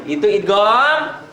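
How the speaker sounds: ordinary talk, nothing marked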